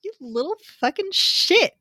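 high-pitched, indignant scolding; mock outrage. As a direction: scold the cheeky character in a squeezed high voice. Mock scolding of Edward's joke.

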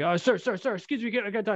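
lightly imitated high-pitched pleading; pestering street magician. Odd guy in a hat stopping a passer-by, high-pitched pestering.

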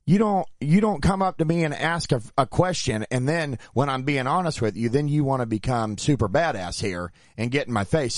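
retold indignant telling-off, high then easing. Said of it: "that's when voices were raised".

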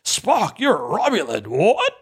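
mock alarmed hothead, pitch swooping high; Ortega. Mocking a crew member traumatized by Romulans, exaggerated alarm. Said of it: "she has to be the one who's like".

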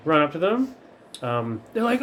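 usual speaking voice

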